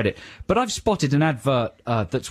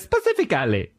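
put-on Italian accent; 3 semitones higher than usual. Showing how the chef says "specifically", Italian accent. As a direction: make a meal of "specifically", mock Italian. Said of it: "because he's Italian".

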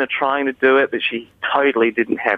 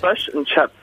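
Kiwi vowels, "fush and chups"; clipped New Zealand accent; 4 semitones higher than usual. Shown as a demonstration. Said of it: "it's slightly more clipped accent".

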